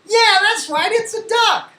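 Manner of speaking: high-pitched, delighted teacher cheer; big, high classroom encouragement; excited praise. Pretend. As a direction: celebrate a young pupil's right answer. Praising pretend pupil's answer.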